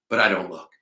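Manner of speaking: usual speaking voice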